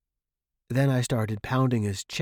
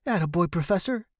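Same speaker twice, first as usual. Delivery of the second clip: quick, higher-pitched cheer; encouraging; 7 semitones higher, 4 dB louder, faster than usual. A narrator's reading. Student to professor waking up, encouraging.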